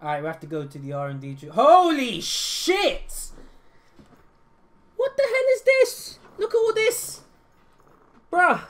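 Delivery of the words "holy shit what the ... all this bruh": high, loud astonished outburst; stunned exclaiming. Real, not acted. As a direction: gasp at the huge menu. Overwhelmed by R&D tree.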